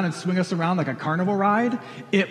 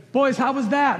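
higher, louder call across the pit; dad yelling from the crane; 7 semitones higher, 5 dB louder than usual. Retold or imitated. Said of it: "he would yell from the crane".

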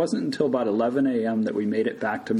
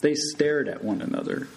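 ordinary delivery

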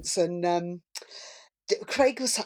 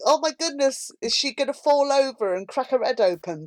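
mock alarm; 6 semitones higher, 4 dB louder than usual. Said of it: "looking through my fingers going".

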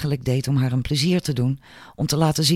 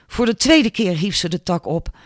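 ordinary delivery

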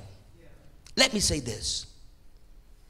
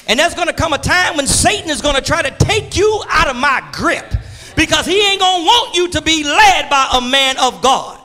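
raised preacher's call; preaching; 9 semitones higher, 5 dB louder than usual. Acted. Preacher calling out to the congregation, raised.

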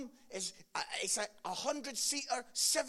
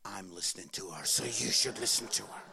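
God's voice; 13 semitones lower, 4 dB louder than usual. Retold or imitated. God voiced in story.

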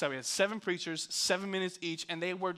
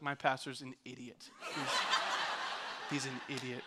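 low, quiet aside in disbelief; hushed, lowered mock thought; muttered inner thought; 6 semitones lower, 7 dB quieter than usual. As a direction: mutter it to yourself about someone. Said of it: "I could hear his thoughts".

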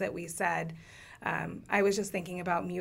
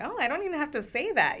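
pleasantly surprised; 8 semitones higher, 4 dB louder than usual. Retold or imitated. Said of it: "and I'm like".

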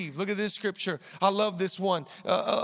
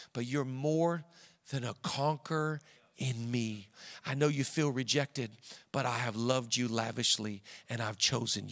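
tender voice of God; 7 semitones lower than usual. Retold or imitated. God's comforting words voiced tenderly.

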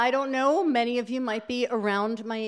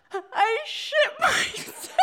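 crying confession; 13 semitones higher, slower than usual. Retold or imitated. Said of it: "and I started to cry".

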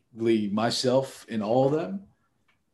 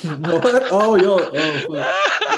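loud surprised laughing outburst; startled laugh; 6 semitones higher, 8 dB louder, much slower than usual. Real, not acted. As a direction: burst out laughing in shock.

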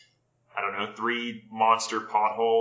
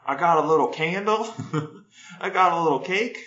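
high, louder deadpan interviewee; local guy voice; 6 semitones higher, 3 dB louder than usual. Retold or imitated. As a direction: answer it like a local on the news. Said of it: "he's like".

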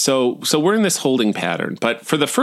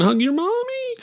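very high squeaky whine; mock wail. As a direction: wail it in a tiny, high-pitched voice. Mock wail about missing parents.

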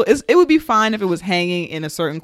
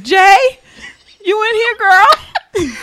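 surprised visitor; 9 dB louder, much slower than usual. Retold or imitated. Voicing visitor to her apartment.

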